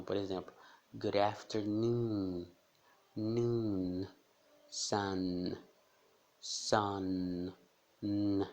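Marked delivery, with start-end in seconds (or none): 0.9-2.5 good afternoon, slowly, ending on a held N; good afternoon, tongue on the palate for the N; slow clear final N. Shown as a demonstration. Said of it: "É um som bem diferente". 3.1-7.5 sun ending with tongue on the palate; sun, hold the N; held final N. Shown as a demonstration. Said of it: "Vai no céu da boca".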